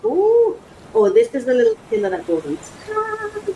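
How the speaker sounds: delighted. Real, not acted. Unboxing, delighted.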